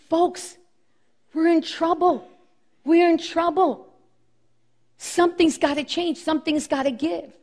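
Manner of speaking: high, earnest appeal to the people; Mordecai crying out. Acted. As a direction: call a warning out to everyone at the gate. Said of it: "and saying".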